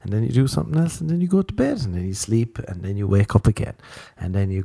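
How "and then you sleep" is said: ordinary delivery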